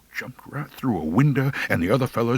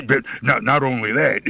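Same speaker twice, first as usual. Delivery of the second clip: ordinary delivery, nothing marked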